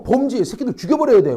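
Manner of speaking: lightly acted indignant bystander; outraged onlooker. Outraged bystander judging two men kissing, mock anger.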